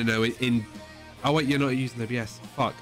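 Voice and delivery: usual speaking voice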